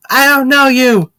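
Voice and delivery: put-on high, loud child's snub; a small kid cutting off a stranger; little girl voice. Pan telling the stranger off, in a high loud child voice.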